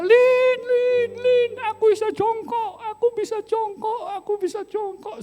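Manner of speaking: high-pitched, re-enacted shout to a wife; excited yell. Husband calling his wife because he can squat, excited yell. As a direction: call out repeatedly in amazed excitement, very high. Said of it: "Saya teriak kepada istri saya".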